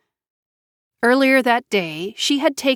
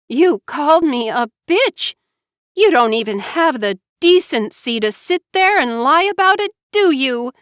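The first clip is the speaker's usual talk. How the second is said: raised, high, outraged; enraged; 7 semitones higher, 3 dB louder than usual. A narrator's reading. High raised outrage. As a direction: Mara Joy enraged at being called names.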